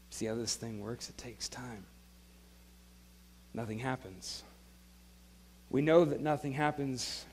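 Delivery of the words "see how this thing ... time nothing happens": hushed aside. Real, not acted. Preacher drops voice for emphasis.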